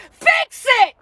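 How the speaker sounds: one punchy shouted demand; shouted command. Real, not acted. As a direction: bark it at them to fix it.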